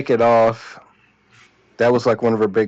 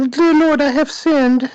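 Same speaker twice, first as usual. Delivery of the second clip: high, hammed-up plea to God; mock confession; 4 dB louder, slower than usual. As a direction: overplay a sinner begging.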